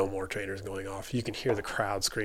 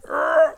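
high, loud "arr" screech; imitated jamming noise; 12 dB louder than usual. Rider describing a wheel hitting the seat, imitated noise. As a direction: mimic a wheel jamming with your voice.

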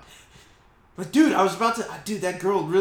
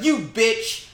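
loud, high mock outrage; mock outraged yell; 6 dB louder, slower than usual. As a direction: shriek it as an offended woman. Character's outburst retold.

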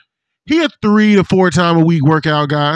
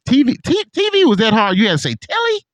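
high-pitched, disbelieving questions; mock incredulity; 8 semitones higher than usual. Joking about other countries' word for T V, incredulous. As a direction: balk at the foreign word in a raised pitch.

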